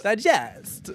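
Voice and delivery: Digest pitched high, teasing the odd stress; wrong-syllable stress. Retold or imitated. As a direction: mimic Digest with the weight on -gest. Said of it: "Put the emphasis on, like, the wrong syllable".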